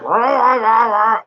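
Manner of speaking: high, loud rah rah rah; mock yell. Dad mimics his son yelling at him. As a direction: imitate the kid yelling back. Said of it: "and yelled at me".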